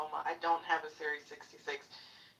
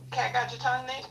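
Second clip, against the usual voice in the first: mocking taunt; 7 semitones higher, 4 dB louder than usual. Real, not acted. Mocking silent heckler.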